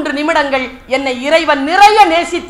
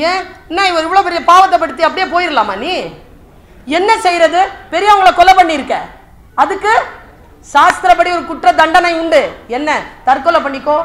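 loud, high rebuke; acted stern reprimand; scolding; 5 semitones higher, 4 dB louder than usual. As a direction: take him to task forcefully. Krishna scolds Arjuna and passes sentence.

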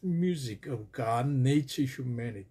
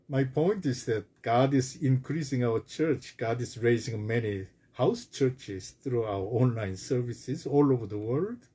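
ordinary talk

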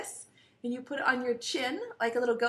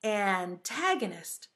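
slow, lower model of the word; word demo; 5 semitones lower, slower than usual. Shown as a demonstration. Teacher modelling a new word for preschoolers, slowly. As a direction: say it slowly for repeating. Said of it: "Can you say it again?".

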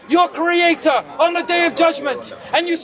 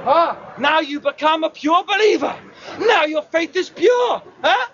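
usual speaking voice

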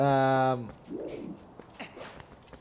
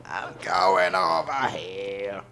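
slow, drawn out odd voice; a slow mock character voice; slow put-on voice; 3 semitones lower, much slower than usual. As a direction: stretch every word like a character. Repeats line in two voices.

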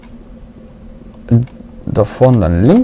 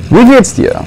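bright sing-song hello, higher up; high cheerful greeting; 12 semitones higher than usual. Real, not acted. Fröhliche Begrüßung einer Figur. (hohe, fröhliche Begrüßung)

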